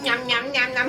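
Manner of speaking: quiet playful nom nom; mock chewing sounds; soft munching noise. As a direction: pretend the toy eats. Toy eating apple.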